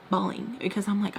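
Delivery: normal talking voice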